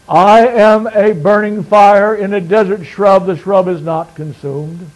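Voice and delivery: fiery sermon climax, words hammered out; preaching at full force. Acted. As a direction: drive the point home to the congregation.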